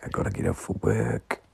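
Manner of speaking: put-on whispered Australian accent; early-morning Australian whisper. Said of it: "impersonate Aussie Dave when he leaves a voice note".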